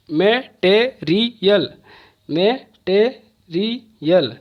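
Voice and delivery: "Material" very slowly as मै, टे, री, यल; four isolated beats on "material"; syllable by syllable. Shown as a demonstration. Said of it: "वैसे ही चार syllable है". ("Material" का हर हिस्सा अलग, बहुत धीरे)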